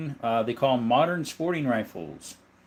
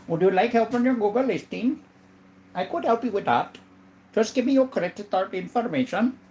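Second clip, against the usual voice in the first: mock scam caller; 8 semitones higher than usual.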